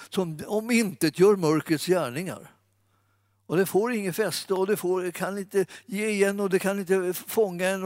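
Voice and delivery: ordinary talk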